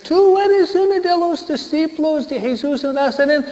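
very high, put-on voice of a third accuser; shrill final accusation in an acted-out voice; the third accuser's high insistent voice. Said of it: "Viene una tercera vez".

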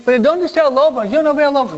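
high, rapid puzzled villagers; hombres desconcertados. Retold or imitated. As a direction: demand answers as the men who came running. Men question the boy. (aldeanos confundidos, voz alta y rápida)

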